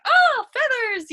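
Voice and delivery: a dog that just found a treasure; thrilled dog. Retold or imitated. Dog finding the chicken under the wheelbarrow, giddy high cry. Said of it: "he was like".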